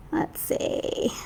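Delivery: soft unvoiced murmur to herself; whispered aside. Real, not acted. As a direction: breathe it under your breath. Murmuring to herself while reading.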